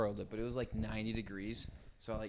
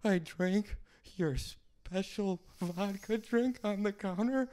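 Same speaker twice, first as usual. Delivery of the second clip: put-on panicked tearful kid; 11 semitones higher than usual. Eleven-year-old confessing to his dad, high, teary panic. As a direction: plead like a terrified little boy. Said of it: "trying not to cry".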